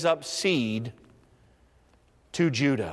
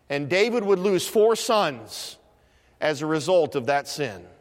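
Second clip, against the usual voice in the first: usual speaking voice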